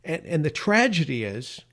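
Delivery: ordinary talk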